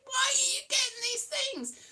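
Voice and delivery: high exasperated cry, imitating. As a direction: throw it up high, fed up. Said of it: "it's just like".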